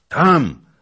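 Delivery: one loud, high shout; thunderous reply. A narrator's reading. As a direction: fire the word back at full volume. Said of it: "odparł gromko".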